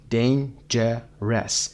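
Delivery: "Dangerous" broken into dain, je, res; very slow, separated beats in "Dangerous"; syllable by syllable. Shown as a demonstration. Teacher breaking the word up for learners, "Dangerous" in three beats.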